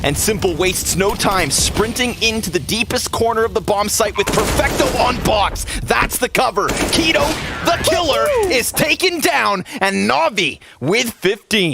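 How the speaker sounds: live play-by-play. Real, not acted. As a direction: call the round live with rising hype. Casts round live.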